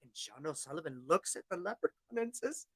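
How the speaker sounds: plain reading voice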